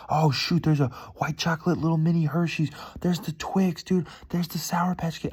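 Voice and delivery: high, loud reenacted candy excitement; hyped-up, high-pitched retelling; getting hyped. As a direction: gush like a kid spotting treats. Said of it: "I'm, like, getting so hyped".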